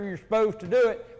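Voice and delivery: ordinary delivery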